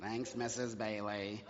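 put-on low, slow, mumbled thanks from a kid. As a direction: mutter it like a bored eight-year-old. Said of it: "they'd be like".

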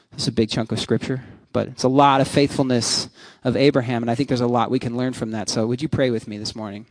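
ordinary talk, nothing marked

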